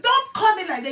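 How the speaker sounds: high, loud offended snap; indignant retort. Retold or imitated. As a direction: throw back her angry protest. Quoting woman's angry reply again.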